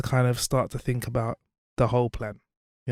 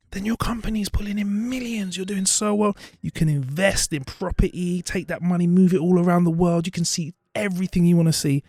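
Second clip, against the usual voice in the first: higher, eager salesman selling a dream; a businessman hyping up the fisherman, higher and eager; excited businessman pitch; 7 semitones higher than usual. Retold or imitated. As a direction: pile it on like a pushy businessman. Businessman pitching riches in story.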